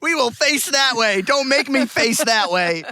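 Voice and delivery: high, slow, loud retort; drawn-out hammed-up warning; mock threat. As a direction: shout it back in pretend outrage. Mock threats traded back.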